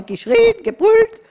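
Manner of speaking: high, loud mock yell; hammed-up wail; mock scream. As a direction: act out the child's screaming. Daughter screaming for more food.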